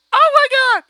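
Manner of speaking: high, loud shriek of joy; real giddy shriek; excited shriek. Real, not acted. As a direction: burst out with delight. Excited outburst.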